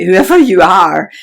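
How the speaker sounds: a sudden loud push on whoever you are; rallying shout; loud emphatic call. Real, not acted. Emphatic encouragement to the viewer.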